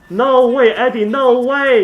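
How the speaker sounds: loud, high, disbelieving yell at Eddie; excited shout. Real, not acted. Streamer shouting at Eddie's outplay, loud and high. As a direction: shout it like you can't believe what you just saw. Streamer reacting to a big play.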